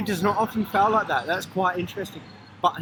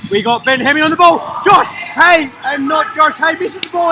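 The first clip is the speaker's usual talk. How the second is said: high, loud running call; excited play-by-play; 7 semitones higher, 7 dB louder than usual. Real, not acted. Commentator calling a missed chance, excited shout. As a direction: shout the players' names as play unfolds.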